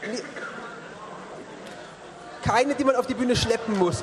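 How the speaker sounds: normal talking voice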